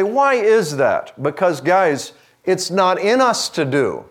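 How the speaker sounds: fervent preaching. Acted. Pastor urging his congregation to surrender, in full preaching force. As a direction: drive the point home to the room, building.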